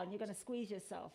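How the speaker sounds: ordinary talk, nothing marked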